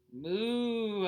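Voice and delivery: loud mock moo; silly cow moo. Child mooing at daddy over milk, loud playful moo.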